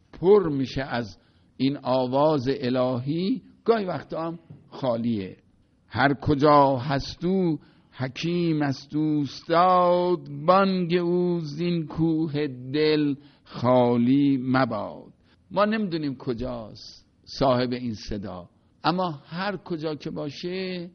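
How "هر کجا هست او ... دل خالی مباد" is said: slow, sonorous verse reading; recited Persian verse; poem recitation. Shown as a demonstration. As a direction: let the line unfold slowly like a chant.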